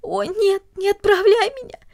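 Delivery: high, tearful wail; wailing plea. A narrator's reading. Cornelli wails at her father as he enters, tearful. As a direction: cry out the plea. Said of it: "запричитала она".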